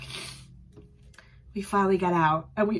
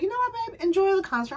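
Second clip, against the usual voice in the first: husband's voice; 11 semitones higher, faster than usual. Retold or imitated. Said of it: "He's like".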